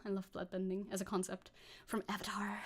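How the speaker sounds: whispered aside. Real, not acted. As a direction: whisper it like a secret.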